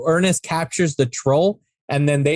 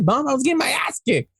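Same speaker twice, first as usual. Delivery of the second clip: retold mad kid; 6 semitones higher, faster than usual. Kid complaining to his mom, mock mad. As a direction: whine it as an imitated indignant kid. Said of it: "gets all mad".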